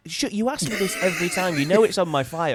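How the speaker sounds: high, loud fed-up snap; mock irritated rant. To the officer who always asks, irritated and loud. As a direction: scold the officer for asking again. Annoyed at repeated questioning.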